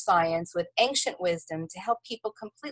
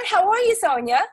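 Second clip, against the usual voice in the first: high, bright greeting; excited; 12 semitones higher, 4 dB louder than usual. Real, not acted. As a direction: greet her with real excitement. Host's bright opening greeting.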